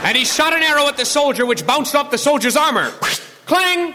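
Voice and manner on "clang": clang sound effect. Acted. Arrow hits armor.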